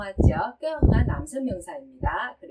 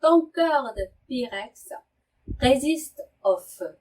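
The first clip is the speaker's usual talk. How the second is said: French sentence said very slowly, syllable by syllable; careful French teacher reading; slow model reading; much slower than usual. Shown as a demonstration. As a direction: read it out slowly for learners. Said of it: "이렇게 발음하는데요".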